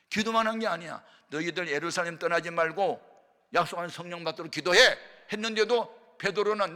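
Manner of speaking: loud, high, pressing pulpit delivery; full-force preaching. Real, not acted. Preacher relaying the command to stay and pray, loud high exhortation. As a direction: hammer it out to the congregation.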